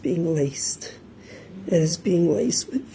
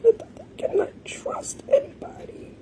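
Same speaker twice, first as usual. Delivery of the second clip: high, hushed, sobbing warning; real sobs breaking a high whisper; crying plea; 4 dB quieter than usual. Real, not acted. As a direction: plead through tears in a whisper. Grieving mother warns other parents.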